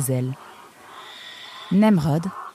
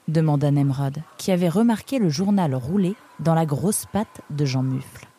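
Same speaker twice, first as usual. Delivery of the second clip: usual speaking voice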